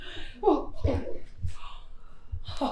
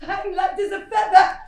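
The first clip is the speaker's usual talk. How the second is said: giddy delight; 4 semitones higher, 8 dB louder than usual. Acted. Giddy joy after waking.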